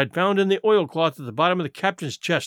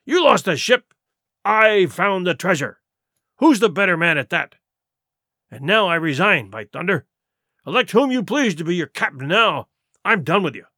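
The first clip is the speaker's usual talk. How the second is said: scolding pirate chief, punching words then dropping to a stern finish; angry pirate leader. Acted. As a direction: dress down an insolent crewman. Silver challenges crew and resigns.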